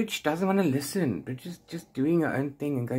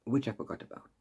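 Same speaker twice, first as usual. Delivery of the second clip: usual speaking voice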